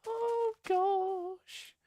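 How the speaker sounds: very high, slow falsetto; slow, childlike squeak of a voice; Tiny Tim. Acted. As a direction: draw it out in a tiny, piping falsetto. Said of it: "It's my impression of Tiny Tim".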